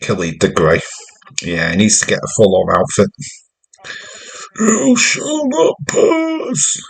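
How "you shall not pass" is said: very high, very slow, hammed-up wizard cry; Gandalf impression. Joking that the wrestler should quote Gandalf, hammed-up wizard cry. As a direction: boom it out like a wizard blocking a bridge.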